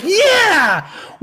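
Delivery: high, loud hammed-up cheer; mock over-excitement. Streamer mocking a caller's keen answer, exaggerated excitement. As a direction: imitate someone wildly thrilled, shouting and whooping. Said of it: "Why'd you sound so excited about that?".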